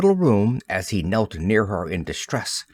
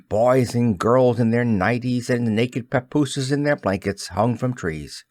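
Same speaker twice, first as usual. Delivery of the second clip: ordinary delivery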